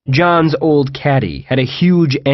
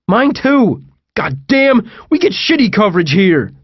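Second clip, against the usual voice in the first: high, raised-voice complaint; exasperated yell; 9 semitones higher, 3 dB louder than usual. A narrator's reading. Friend finding no phone signal mid-chase, exasperated yell. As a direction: cry out in frustration at the phone. Exclaiming over dead phones while fleeing.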